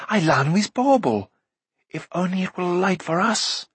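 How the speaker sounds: high, eager exclamation; crying out. A narrator's reading. Youth remembering a light source, eagerly crying out. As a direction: seize on a sudden hope, pitch up. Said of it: "he cried".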